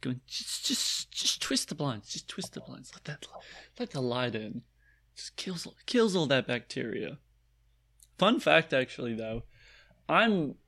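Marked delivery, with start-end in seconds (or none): 0.3-7.2 urgent whisper. Retold or imitated. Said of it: "this guy just going".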